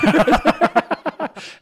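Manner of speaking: loud, high burst of laughter; real hearty laugh; big laugh. Real, not acted. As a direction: crack up at your own mistake. Battery found all along.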